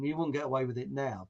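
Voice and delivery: normal talking voice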